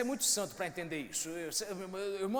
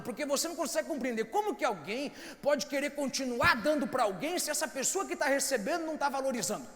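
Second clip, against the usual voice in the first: loud, higher impassioned sermon; fervent preaching; 5 semitones higher, 5 dB louder than usual. Real, not acted. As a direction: pour it out at full force. Preaching at full force. (pregação fervorosa, alta e intensa)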